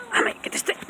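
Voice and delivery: whisper. Real, not acted.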